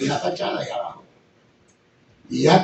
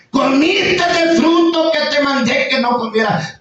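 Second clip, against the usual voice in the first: higher, slow, solemn God voice; God rebuking Adam, slow and raised; God speaking; 7 semitones higher than usual. Retold or imitated. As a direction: draw each word out like God judging. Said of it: "le dijo Dios".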